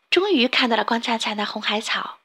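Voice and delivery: ordinary delivery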